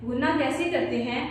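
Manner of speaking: normal talking voice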